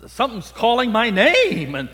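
asking in breathless wonder if God calls; startled wonder. Retold or imitated. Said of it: "And I'm thinking".

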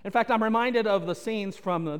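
normal talking voice

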